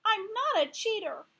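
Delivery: loud, high protest; insisting. Acted. Heidi loudly protesting she is no cheater. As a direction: hammer the denial home.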